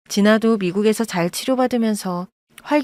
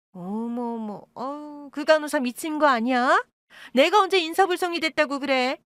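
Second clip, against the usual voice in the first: higher, indignant denial; mock outrage; 6 semitones higher than usual. Birth mother denying the nurse's story, higher indignant protest. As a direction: play wounded innocence, higher. Mother denies being drunk.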